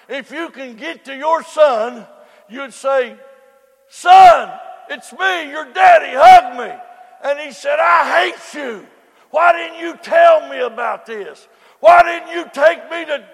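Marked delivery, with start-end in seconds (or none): 3.9-6.8 loud, high desperate plea; father begging son. Acted. Father in hell pleading with his son, loud and desperate. 7.8-13.3 a hint of a bitter son shouting; angry son. Son in hell accusing his father, mild angry shout.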